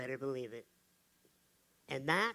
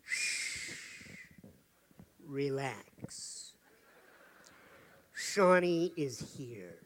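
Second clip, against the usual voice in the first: slow, soft calming hush; calm her down like a joke hotline; mock soothing; 7 semitones lower, 4 dB quieter, much slower than usual. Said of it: "I actually have a protocol".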